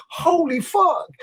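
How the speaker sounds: very high, mock-stunned exclamation; retold astonishment. Diner learning that was just the starter, high retold astonishment. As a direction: replay your stunned reaction, pitched high. Retold shock at endless courses.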